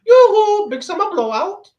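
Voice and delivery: high loud singsong yoo-hoo; Frozen shopkeeper impression. Imitating the shopkeeper from Frozen calling out a sale in a high singsong. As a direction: sing it out like an eager shopkeeper. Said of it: "the guy from Frozen".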